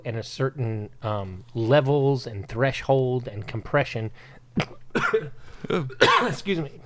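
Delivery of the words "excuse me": coughing, throat caught on a drink; choking on a drink. Real, not acted. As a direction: cough through it, then a strained excuse. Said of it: "Choking on whiskey over here".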